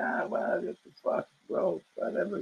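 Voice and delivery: soft, whispered shrug-off; dismissive. Retold or imitated. Younger bouncer shrugging off the woman, whispered and dismissive. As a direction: brush it off under your breath.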